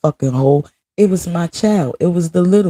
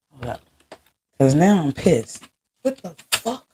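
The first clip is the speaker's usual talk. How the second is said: ordinary talk, nothing marked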